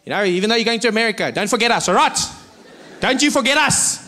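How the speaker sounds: high, insistent stranger's voice; pleading fan. Acted. Stranger warns the comedian before America, high and insistent. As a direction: put on a fan making demands. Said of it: "Strangest things people would say to me".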